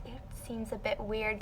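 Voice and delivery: softly imitated teacher's suspicion; hushed, tentative remark; discreet doubt. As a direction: confide it under your breath.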